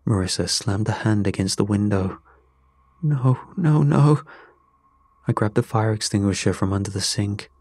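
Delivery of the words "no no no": higher, growing louder in alarm; alarmed protest. A narrator's reading. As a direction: cry out in dismay, building. Horror at the fire.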